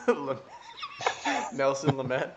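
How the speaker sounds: laughing. Real, not acted. Laughing at the name mix-up.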